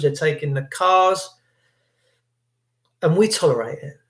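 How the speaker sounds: normal talking voice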